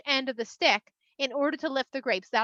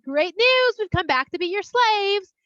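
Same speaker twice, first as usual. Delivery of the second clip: mock good news; 6 semitones higher, 5 dB louder, slower than usual. People imagine announcing return to Egypt.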